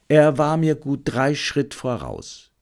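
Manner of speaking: ordinary delivery, nothing marked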